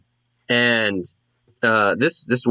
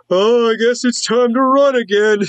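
high comic cry of alarm; high-pitched panicking townsperson, played for laughs; hammed-up panic; 13 semitones higher, 4 dB louder than usual. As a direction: wail it in mock panic. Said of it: "people start to scream in panic".

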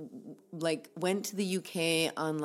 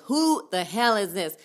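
angry demand; 4 semitones higher, 9 dB louder than usual. Retold or imitated. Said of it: "I can't do a South African accent".

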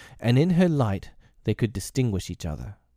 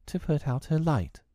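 put-on higher-pitched girlish plea; begging; 7 semitones higher, 4 dB quieter than usual. A narrator's reading. Wendy pleads with Peter about Tink's light, begging. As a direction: plead in a high voice. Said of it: "Wendy begged".

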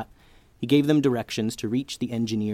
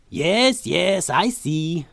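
high, slow musing inventor; eccentric scientist; 6 semitones higher, 4 dB louder, much slower than usual. A narrator's reading. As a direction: draw it out, distracted. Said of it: "said the man with the crazy hair".

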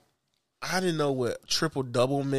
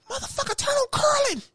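high, hammed-up call to turn on curling; mock call out. Friend imitating himself calling out for curling, high and hammed-up. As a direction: squeal it like an excited fan.

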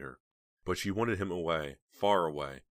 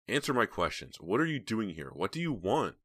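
higher, louder, insistent questioning; angry demand; 5 semitones higher, 4 dB louder than usual. A narrator's reading. Heather interrogates the unwanted visitor, demanding angrily. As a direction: press him hard for answers.

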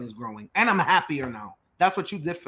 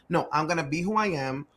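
normal talking voice

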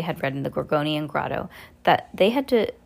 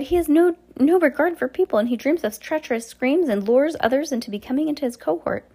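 protesting; 9 semitones higher than usual. Acted. Fiona's protest continues.

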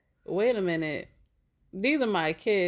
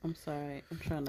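softer, holding back laughter; stifled giggles; 4 semitones lower, 8 dB quieter than usual. Real, not acted. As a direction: apologize while fighting a giggle. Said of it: "I'm trying not to laugh".

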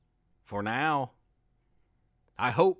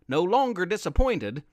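usual speaking voice

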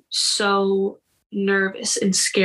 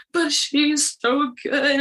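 nervous protest; 5 semitones higher, slower than usual. Retold or imitated. Retold nervous protest to coach.

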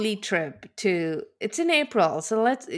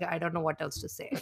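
uncomfortable; 5 semitones lower, 12 dB quieter than usual. Retold or imitated. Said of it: "are so uncomfortable with each other".

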